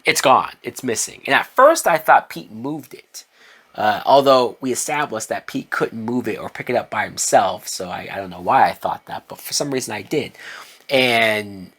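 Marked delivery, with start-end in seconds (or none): none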